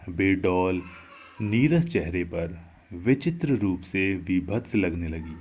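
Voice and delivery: ordinary delivery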